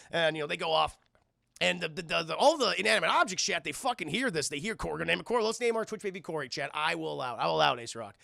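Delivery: ordinary talk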